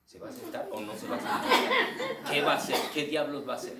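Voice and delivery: loud, high, laughing impatience; público intrigado. Acted. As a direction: act out an audience desperate to know. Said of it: "¿Y ahorita dónde están?". (público impaciente, fuerte y agudo)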